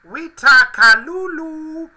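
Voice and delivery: high, slow, squeaky toy voice; high Furby voice. Pretend. Furby phrase read out again.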